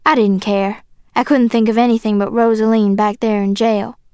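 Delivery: plain reading voice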